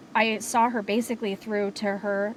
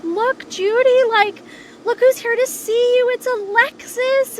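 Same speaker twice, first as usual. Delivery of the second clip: cheery nurse voice; 13 semitones higher, 7 dB louder than usual. Retold or imitated. Nurse greeting Judy to announce her granddaughter, high cooing sing-song. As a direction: imitate a nurse cooing to an old patient.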